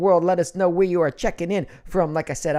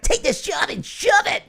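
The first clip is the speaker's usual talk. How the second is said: high cartoon character voice; Digimon voice; 14 semitones higher, 4 dB louder than usual. Shown as a demonstration. Host quits a fan's job in Digimon voice. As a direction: shout it defiantly as a high-pitched cartoon hero. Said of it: "I'll do it in the Digimon voice".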